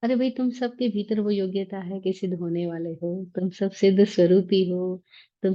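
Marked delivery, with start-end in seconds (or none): none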